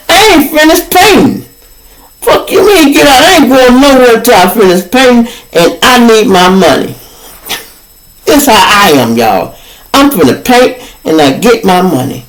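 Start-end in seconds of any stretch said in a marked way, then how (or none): 0.1-6.9 defiant retold yell. Refusing to leave, defiant.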